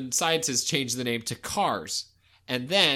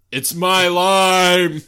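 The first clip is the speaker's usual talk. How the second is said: loud slow insistence; 5 semitones higher, 11 dB louder, much slower than usual. Acted. Vin Diesel claiming his lime.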